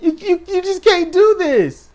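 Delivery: high, loud disbelief; exasperated outburst. Real, not acted. Complaining about the storyline, high and exasperated. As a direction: throw up your hands at it.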